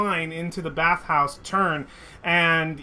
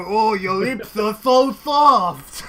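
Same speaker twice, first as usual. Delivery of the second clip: doting auntie; 3 semitones higher, 5 dB louder, slower than usual. Acted. Old aunt after kiss.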